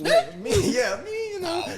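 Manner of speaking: very high squeaky mock-innocent voice, laughing; mock innocence. Pretending to the cellmate he'd never snitch, high squeak. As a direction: squeak it out like a fake angel.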